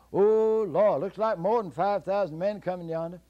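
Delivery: high, loud put-on fright; high loud pretend dismay; mock alarm. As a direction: fake a panic for the giant. Jack fakes alarm.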